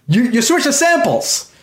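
high-pitched mock yell, no real volume; retold heckle yelled from the back row; imitated yell. Heckler to theater employee from the very last row, mock yelling. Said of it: "I'm yelling top of my lungs".

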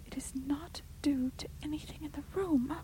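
high, soft whisper of dread; fearful whisper. A narrator's reading. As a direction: whisper it, terrified. Rebecca repeating in dread.

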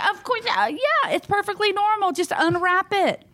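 high-pitched mock nonchalance; acting like it's normal. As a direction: retell it as breezy, high-voiced reassurance. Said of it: "We're all acting like this is normal".

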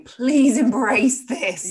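slow, high, tender plea; mock-tender please embrace this; endearing. Shown as a demonstration. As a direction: coax it gently, lovingly. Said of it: "in an endearing kind of way".

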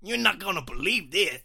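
loud, high hammed-up New York accent. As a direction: belt it out as a cartoonish New York boy. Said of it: "shall I do a New York accent".

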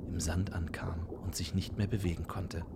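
storyteller whispering; whispered narration. A narrator's reading. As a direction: hush it like a secret.

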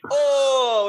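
high, drawn-out pained oh; retold wince. Storyteller re-enacting the band seeing a friend fall, high dismayed groan. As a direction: imitate onlookers wincing at a nasty fall.

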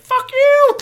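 very high, loud squawk; the parrot shrieks back at the driver. Acted. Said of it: "The parrot's like".

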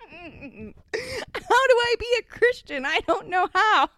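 put-on high, loud boyish questioning; hammed-up child demanding to know how; child interrogating. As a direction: burst out high and loud, bewildered. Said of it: "but then interrogated".

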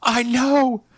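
high panicked groom. Acted. Groom answering the princess, high and panicked. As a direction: squeak it high and urgent.

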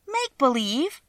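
high-pitched incredulous question; astonished cry. A narrator's reading. Dorothy questioning the little man, crying out in surprise. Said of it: "cried Dorothy".

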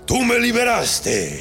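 slow, booming genie voice; badass genie voice. Genie to the man who freed him, slow and booming. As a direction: play the genie big and slow, savoring each word. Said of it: "tiene que tener una voz chingona el genio".

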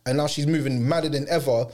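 normal talking voice

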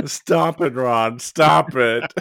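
hammed-up know-it-all telling Ron off; imitating Hermione nagging; bossy Hermione. Quoting Hermione scolding Ron.

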